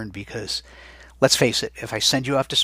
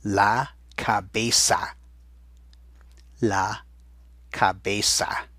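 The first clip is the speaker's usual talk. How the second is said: slow dictation; slower than usual. Shown as a demonstration. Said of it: "They did it again".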